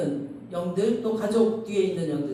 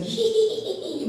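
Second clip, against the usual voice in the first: high, far-off ghostly giggle; mock creepy ghost laugh; imitated ghost cackle; 13 semitones higher, much slower than usual. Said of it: "막 귀신 소리를 내는 거예요".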